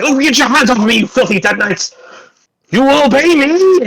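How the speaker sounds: high, outraged commands; indignant shouting. Acted. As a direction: order your captors off at a high pitch. Son fights off Death Knights.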